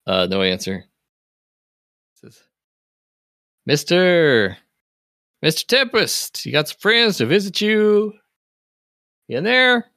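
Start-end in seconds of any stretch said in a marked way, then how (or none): 3.7-9.8 high, slow call to a neighbour; calling through a door. Acted. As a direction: call out to someone behind a door. NPC calls through door to Tempest.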